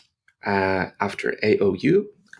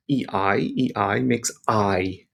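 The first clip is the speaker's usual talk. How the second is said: ordinary delivery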